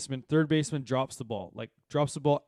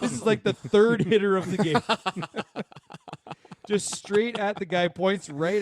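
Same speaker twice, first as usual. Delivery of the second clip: laughing disbelief; 7 semitones higher, 5 dB louder than usual. Real, not acted.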